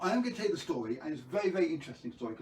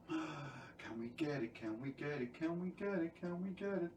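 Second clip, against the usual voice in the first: hushed coaxing; 9 dB quieter than usual. Pretend. Host urging viewers to guess the answer, hushed coaxing.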